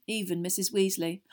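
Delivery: plain reading voice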